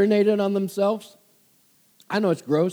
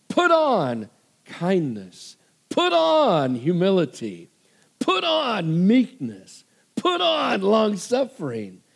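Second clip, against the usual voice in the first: slow emphatic list; slower than usual. Shown as a demonstration. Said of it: "slow emphatic list".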